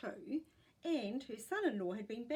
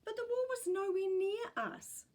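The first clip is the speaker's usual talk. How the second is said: stubborn; 11 semitones higher, 6 dB louder than usual. A narrator's reading. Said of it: "Tyson said stubbornly".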